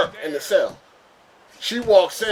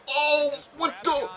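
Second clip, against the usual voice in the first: much higher, slow, gaping mock shock; stunned disbelief; 11 semitones higher, slower than usual. Young newcomer gaping at his cellmate, in high stunned disbelief. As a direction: let it hang in high disbelief. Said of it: "I was like".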